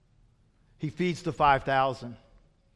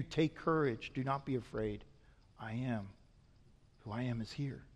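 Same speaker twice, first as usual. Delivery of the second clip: softer, hushed reassurance; gentle reassurance; 4 semitones lower, 8 dB quieter than usual. Retold or imitated. Preacher passing Jesus' comfort to the congregation, soft and reassuring. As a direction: soothe the listener with Jesus' words.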